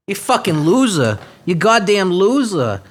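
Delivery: ordinary talk, nothing marked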